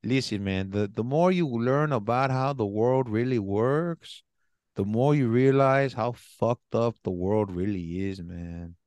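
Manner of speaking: put-on accent of a stoned-sounding Latin American studies teacher; mock stoner-professor accent; imitating teacher's accent. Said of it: "and in this accent".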